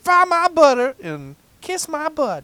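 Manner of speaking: high, slow hammed-up yokel; folksy exclamation. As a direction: exclaim like a hammy country bumpkin.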